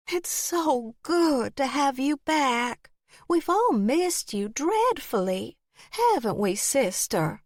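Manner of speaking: high, slow, sweet aunt voice; sweet old aunt. Acted. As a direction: gush out a fond welcome. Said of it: "her welcoming smile soft and warm".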